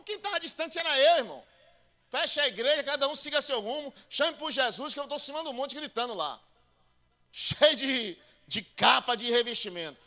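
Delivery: loud, high preaching rant; full-force preaching. Acted. Pastor mocking hiding preachers during pandemic, loud. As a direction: challenge the church mockingly.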